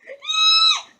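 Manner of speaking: very high, loud squealed gasp; excited squeal. Retold or imitated. Vlogger reacting to a magazine find, excited squeal. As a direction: let out a piercing, delighted squeal. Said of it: "I got so excited".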